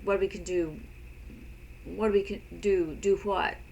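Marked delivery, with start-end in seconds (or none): none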